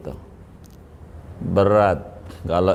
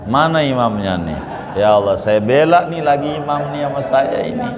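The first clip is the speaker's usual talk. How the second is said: mock indignant outcry; 7 semitones higher, 4 dB louder, faster than usual.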